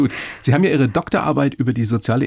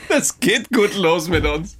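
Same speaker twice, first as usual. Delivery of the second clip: real amused laughter through the words, higher; laughing; 8 semitones higher, slower than usual. Real, not acted. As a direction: say it while laughing, slow and high. Lacht über den Vogel-Witz.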